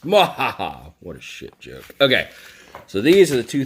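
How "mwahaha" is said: mad scientist. Pretend. Evil laugh after teasing question.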